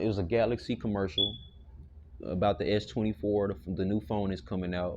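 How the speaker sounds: usual speaking voice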